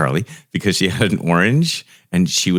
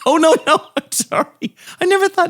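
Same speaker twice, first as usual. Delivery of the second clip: high, laughing, flustered sorry; laughing apology; 3 dB louder than usual. Retold or imitated. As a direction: apologise through laughter, pitching way up. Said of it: "flustered laughing apology retold".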